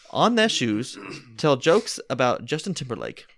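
hammed-up storyteller voice; playful theatrical narration; dramatic story reading. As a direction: read it like a grand dinner theater tale. Reads the Mad Lib story aloud.

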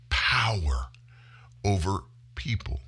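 hushed and heavy on power; dramatic whisper. Real, not acted. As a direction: breathe the word power out in a whisper. Dramatic stress in political rant.

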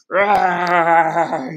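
loud, long held roar; bear roar. Shown as a demonstration. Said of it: "You're like a bear".

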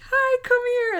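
high-pitched mock delighted greeting; imitated sweet, high-pitched welcome. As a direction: squeal a little hello, imitating delight. Retelling a delighted on-screen greeting.

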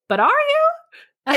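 high, slow, drawn-out "But are you?"; doubtful question. Shown as a demonstration. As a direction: ask it with sharp skepticism, drawn way up. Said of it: "listen to your own voice and, and ask yourself your question".

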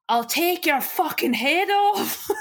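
high, slow imitation of a bellow; mock screamed threat. Co-host retelling housemate threatening Marco, mock screaming. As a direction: draw out a high-pitched mock threat, no real volume. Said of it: "screaming the heads off people".

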